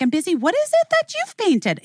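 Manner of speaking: high-pitched imitation of a teacher's question; retold preschool teacher. Teacher asks a preschooler about her painting, high sing-song teacher voice. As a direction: lilt it high, like addressing a small child.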